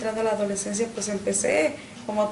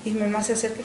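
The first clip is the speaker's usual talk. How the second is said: normal talking voice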